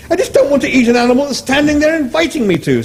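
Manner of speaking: high appalled Englishman; Arthur appalled. Acted. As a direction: squeal with disgust.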